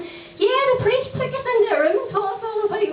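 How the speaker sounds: high mock son; son's voice. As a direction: pipe up like a proud kid.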